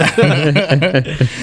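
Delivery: high burst of real laughter; hearty laugh. Real, not acted. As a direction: genuine laughing fit. Laughs at own joke.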